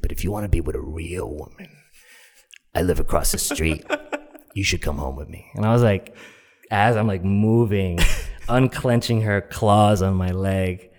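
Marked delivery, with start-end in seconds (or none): 0.0-5.5 low, paused proposition; imitating a forward woman luring someone home; seductive woman. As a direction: make the come-on in a deep voice. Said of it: "just that kind of voice".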